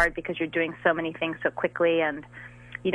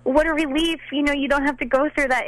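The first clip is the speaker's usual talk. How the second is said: relieved; 7 semitones higher than usual. Retold or imitated. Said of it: "And I thought".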